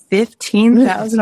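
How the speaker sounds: high, loud, slow disbelieving "Fifteen thousand?"; astonished grandma. Retold or imitated. Grandma, stunned by the McDonald's payment, gasps in disbelief. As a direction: stretch it out in sheer disbelief.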